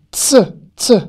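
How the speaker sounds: "Ts, ts" as two separate bursts; isolated TS sound. Shown as a demonstration. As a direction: sound out "Ts, ts", t and s back to back. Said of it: "c'est un T et un S consécutif".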